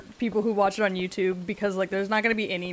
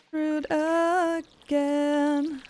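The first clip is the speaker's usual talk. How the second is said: drawn out; 8 semitones higher, much slower than usual. Acted. Stretched sung-out words.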